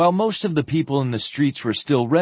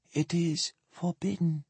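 soft, higher woman's voice; near whisper; 6 semitones higher, 9 dB quieter than usual. A narrator's reading. Ailing woman answers for the girl, hardly above a whisper. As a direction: barely get the words out, weakly. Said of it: "hardly more than a whisper".

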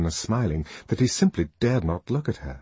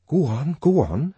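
mildly excited, higher-pitched urging; 7 semitones higher than usual. A narrator's reading. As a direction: eagerly urge the speaker on. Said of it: "said Bernard excitedly".

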